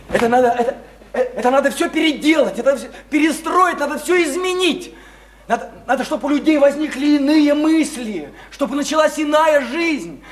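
loud, urgent exhortation; fired-up reformer's speech; impassioned appeal. Acted. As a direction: rouse them to change everything. Impassioned speech calling for change.

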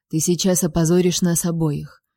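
usual speaking voice

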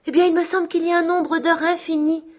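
voix nettement plus aiguë, timbre changé, dubitative; d'un air de doute. A narrator's reading. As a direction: doute perdu d'une malade fiévreuse. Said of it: "murmura-t-elle d'un air de doute". (Catherine, incertaine, voix aiguë et changée)

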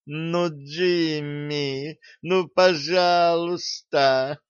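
slow, drawn-out begging; wheedling, stretched-out plea; pleading. A narrator's reading. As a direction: beg him sweetly and slowly. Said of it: "взмолилась Лепесткова".

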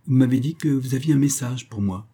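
plain reading voice

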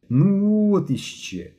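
high, drawn-out grumble; discontented drawl. A narrator's reading. Boy objects to staying for a meeting, discontented drawl. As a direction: stretch it out in protest. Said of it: "незадоволено протягнув Генка".

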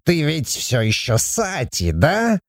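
soft, slow questioning dwarf voice; hushed put-on inquiry; probing dwarf. A narrator's reading. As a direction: test her gently, lifting at the end. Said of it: "Он испытующе посмотрел на Сати и спросил".

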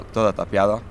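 ordinary delivery

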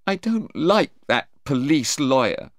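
a touch of tearful complaint; mildly tearful. A narrator's reading. Sarah confiding in her mother, mildly tearful. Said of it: "Sarah cried at first".

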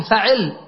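فعل with a after f, i after ʕ; a then i. Shown as a demonstration. Teacher showing students the i-middle pattern, "faʕil". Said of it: "فتح الفاء مع كسر العين".

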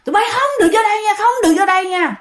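high-pitched, fast refusal; retold angry yelling. Old woman refusing to open up to children, retold angry yelling. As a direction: act out an old woman's rapid scolding. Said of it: "la lối um sùm".

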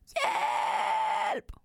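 very high, panicked cry of Hjælp!; trapped mouse shrieking for help; shouting for help. A narrator's reading. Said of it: "råber den".

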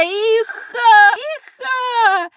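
hee-hawing guffaw, high-pitched; braying snake laughter; donkey-like laugh. Acted. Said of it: "ну, прям как осёл".

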